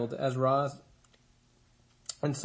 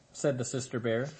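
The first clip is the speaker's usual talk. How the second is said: plain reading voice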